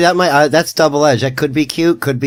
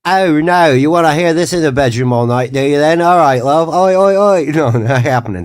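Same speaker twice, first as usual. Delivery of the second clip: higher, louder mock-Australian woman; Australian accent; 4 semitones higher, 5 dB louder than usual. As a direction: do an exaggerated Aussie bedroom voice. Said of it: "Australian on a woman".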